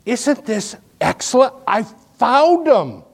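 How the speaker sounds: rejoicing. Retold or imitated. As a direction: glow with delight at the find. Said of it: "You go home going".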